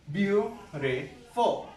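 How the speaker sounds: beautiful stretched out slowly as beau-ti-ful; slow syllable-by-syllable. Shown as a demonstration. Teacher slowly splits beautiful into syllables. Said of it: "three syllables".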